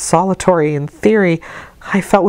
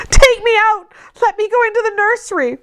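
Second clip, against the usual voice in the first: put-on terrified child's cry. A narrator's reading. Frightened girl begging the servants to let her out, panicked high cry. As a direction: beg to escape the room in panic. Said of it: "was my cry".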